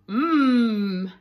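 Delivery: loud hungry hum; a long savouring mmm; wolf's hum of delight. Acted. Wolf smelling his favourite smell, loud savouring hum.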